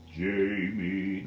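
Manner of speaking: low, drawn-out ghostly call; spooky otherworldly voice. Acted. Medium mocking the idea of hearing voices, spooky otherworldly voice. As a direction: put on a voice from beyond. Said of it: "I don't hear voices like that".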